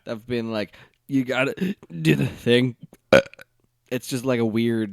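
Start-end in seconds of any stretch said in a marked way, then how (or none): 1.1-2.7 lightly imitated halting comic voice; Bobcat Goldthwait cadence. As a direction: break up the phrase, a little louder. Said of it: "the way Bobcat Goldthwait patterns his speech".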